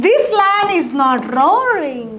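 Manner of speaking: very slow, drawn-out English example; each word laid out slowly for learners; teacher modelling slowly; slow model sentence. Shown as a demonstration. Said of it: "સર્કસનો સિંહ છે".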